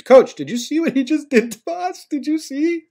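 mock whining to the coach in a high voice; tattling Maldonado. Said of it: "Maldonado's like".

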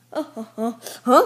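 ordinary talk, nothing marked

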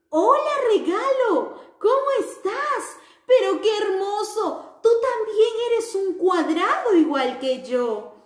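high, bright-eyed little square voice; sing-song greeting for children; cheerful square character. Acted. The square happily greets its friends in a high cheerful voice.

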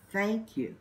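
usual speaking voice

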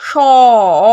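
"স" drawn out into a long "অ"; vokal bawaan ditonjolkan. Shown as a demonstration. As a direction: stretch "স" until the inherent "অ" rings out. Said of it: "এরম হচ্ছে".

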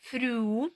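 "Früh" drawn out on a rounded ü; German ü demo. Shown as a demonstration. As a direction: model "Früh" with a long ü, rising. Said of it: "Потренуємося на словах".

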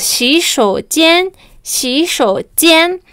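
"xi shou jian" said slowly, "jian" rising; slow word demo. Shown as a demonstration.

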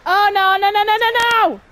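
high, loud string of nos; panicked shouting. Real, not acted. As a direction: yell in real panic. Birds attack in game.

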